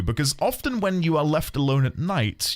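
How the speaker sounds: usual speaking voice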